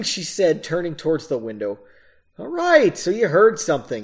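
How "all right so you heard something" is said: ordinary delivery, nothing marked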